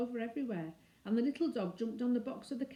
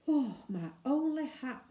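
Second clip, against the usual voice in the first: breathless, slow "oh my only hat"; relieved exclamation; 5 semitones higher, 5 dB louder, slower than usual. A narrator's reading. Cyril sighing with relief after the carriage leaves. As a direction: let the relief out on a long breath.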